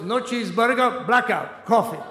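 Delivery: gruff diner owner. Retold or imitated. Greek diner owner to brother, lightly voiced accented refusal.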